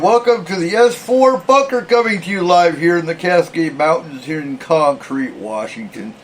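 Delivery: radio host's big welcome; show-opening announcer. Acted. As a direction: open the live show big, stretching the greeting. Opens the show as host.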